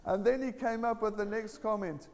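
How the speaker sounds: high-pitched, emphatic testimony; impassioned preaching. Real, not acted. Telling church about an atheist proclaiming Jesus, impassioned preaching.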